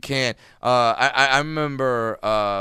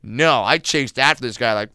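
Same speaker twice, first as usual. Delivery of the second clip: ordinary delivery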